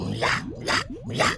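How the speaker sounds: impatient hissing creature; basilisk. Acted. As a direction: hiss it out impatiently. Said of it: "Stimme klang ungeduldig".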